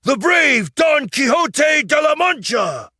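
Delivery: high, loud, overblown squire's address; high shouting flattery; grand proclamation. Acted. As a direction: kneel and proclaim to the queen. Kneeling squire's grand speech.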